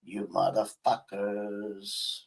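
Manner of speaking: very slow, drawn out; slow, dragging annoyed drawl. Real, not acted. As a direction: stretch every word out slowly. Slow drawn-out fiddling phone.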